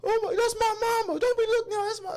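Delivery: very high mock panic; hammed-up high, frantic pleading; imitating panicked son. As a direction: squeal in horror at spotting mama. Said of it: "the guy was like".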